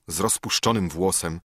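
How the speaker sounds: usual speaking voice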